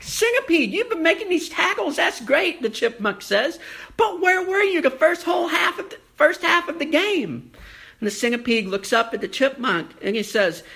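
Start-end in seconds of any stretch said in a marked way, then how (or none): 0.0-2.6 high, fast cartoon chipmunk. A narrator's reading. Chipmunk praising the centipede, high and quick. 4.0-7.5 high, fast cartoon chipmunk. A narrator's reading. Chipmunk praising the centipede, high and quick.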